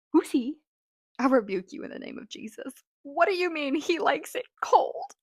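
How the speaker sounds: loud, high-pitched disbelief; hammed-up incredulous outcry; mock outrage. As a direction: react loudly, playfully horrified by the creepy line. Mock horrified reaction to quote.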